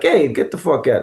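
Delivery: restrained New York demo; toned-down New York accent. Shown as a demonstration. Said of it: "then you bring it down".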